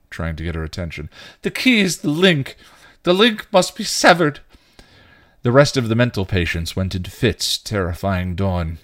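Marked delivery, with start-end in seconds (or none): 1.4-4.4 high, loud madman's decree; crazed proclamation. A narrator's reading. Insane knight terrifies Dawn, crazed proclamation. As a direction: ring it out like a deranged prophet. Mad patient proclaims doom.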